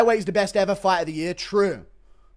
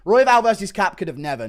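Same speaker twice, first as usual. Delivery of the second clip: ordinary delivery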